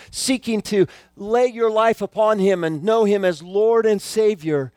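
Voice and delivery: usual speaking voice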